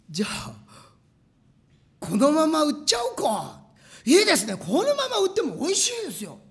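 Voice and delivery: high, loud eager voices; excited praise. Retold or imitated. Employees to the president, excited praise. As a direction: gush over the taste.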